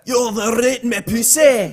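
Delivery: loud, drawn-out mock Scots; broad Scottish burlesque; Scottish accent. Co-host mocking Scottish women's talk, slow Scottish accent. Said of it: "Do they talk like that?".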